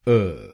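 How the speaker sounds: short neutral अ on its own; a quick unstressed अ sound; isolated syllable अ; syllable demo. Shown as a demonstration. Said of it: "ए को बोलिए अ".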